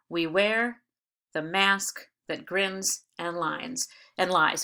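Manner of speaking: slow, pausing after each foot, stressing "wear", "mask", "grins", "lies"; foot-by-foot scansion. Shown as a demonstration. Teacher counting four feet, separated pairs with stress on each second word. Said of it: "That's four feet in this line".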